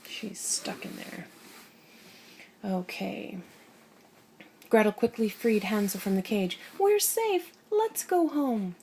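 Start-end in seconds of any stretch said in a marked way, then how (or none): 0.1-1.3 soft whispered aside; whispering. Real, not acted. 6.8-8.8 very high, slow, joyful; happy sing-song. Acted. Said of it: "they sang happily".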